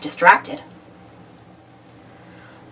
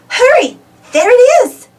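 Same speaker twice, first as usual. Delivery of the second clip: high, louder, hurrying him on; excited call; 14 semitones higher, 6 dB louder than usual. A narrator's reading. Uniqua urging Pablo toward the ship, excited call. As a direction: point and call out eagerly.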